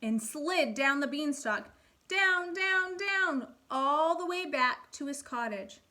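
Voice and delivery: put-on lively storybook reading for kids; animated read-aloud for first graders; reading to children. A narrator's reading. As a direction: read it like a picture book at storytime. Teacher reads fairy tale aloud.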